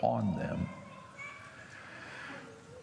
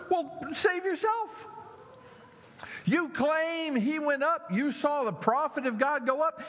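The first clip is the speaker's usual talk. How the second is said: mocking taunt. Preacher voicing the jeering crowd, mocking taunt. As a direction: goad him like a schoolyard bully.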